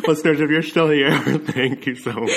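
giggling through the words; can't stop laughing. Real, not acted. Both laughing at eating noises.

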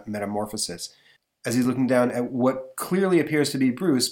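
plain reading voice

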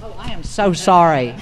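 ordinary delivery

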